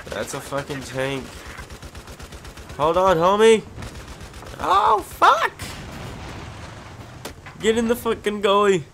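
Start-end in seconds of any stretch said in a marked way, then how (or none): 2.8-8.8 high, loud calls to a buddy; rally your partner in a panic; urgent shouting. Real, not acted. Player urging his teammate into cover, loud and frantic.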